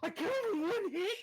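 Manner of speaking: high, fast shocked exclamation; loud, high-pitched amazement; astonished. Real, not acted. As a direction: blurt it out in disbelief after a gasp. Player learns of one-hit kill.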